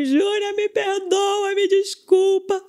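high, drawn-out tearful pleading; imitated crying. Disciple on FaceTime begging her leader's forgiveness, mock crying. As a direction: imitate a sobbing apology, words stretched out high. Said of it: "e ela chorando assim".

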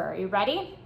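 ordinary talk

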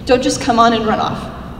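normal talking voice

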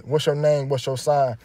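normal talking voice

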